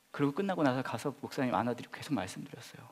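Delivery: ordinary delivery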